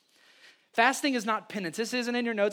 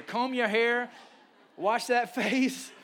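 higher, slow teasing "comb your hair"; playful mock command; 4 semitones higher, slower than usual. Pastor joking with congregation, playful mock scolding. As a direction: tease it like a parent's order. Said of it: "That's somebody's life verse right there".

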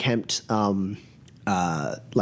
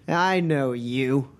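put-on drunk; slower than usual. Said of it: "kinda wobbling as he's standing there".

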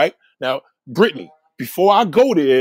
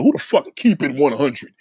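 normal talking voice

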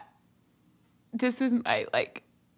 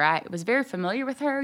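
usual speaking voice

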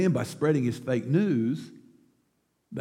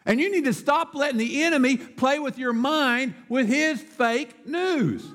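higher, projected sermon delivery; emphatic preaching; 8 semitones higher than usual. Real, not acted. Preacher urging Christians to speak up, emphatic. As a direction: drive each phrase home to the congregation.

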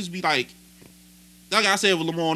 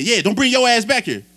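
louder, higher mock of a warning; imitated angry threat; 5 semitones higher, 5 dB louder than usual. Bellman warns departing delivery driver off, imitated anger. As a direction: throw it out as a confrontational warning.

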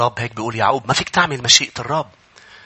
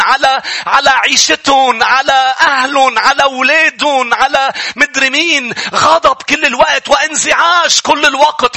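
high, loud fervent sermon delivery; impassioned pulpit preaching; full-force preaching; 13 semitones higher, 5 dB louder than usual. Acted. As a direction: preach it out to the whole congregation.

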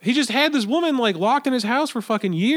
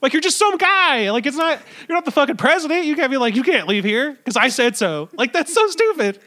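higher, indignant rant about a petty kidnapper; worked up; 8 semitones higher than usual. Real, not acted. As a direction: rant higher and more heated, getting worked up. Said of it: "I'm really getting worked up about this".

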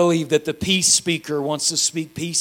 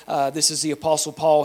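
ordinary delivery

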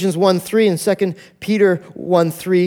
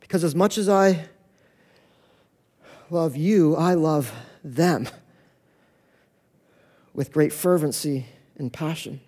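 softer and slow, lower; tender love; 4 semitones lower, 6 dB quieter than usual. Retold or imitated. The Father telling his son how he loves them, softly and slowly. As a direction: let it sink to a hush of love.

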